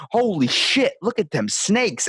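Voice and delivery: high, drawn-out amazed stoner voice. Retold or imitated. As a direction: gawp at snakes like a stoned TV host. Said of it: "[name], Animal Planet videos".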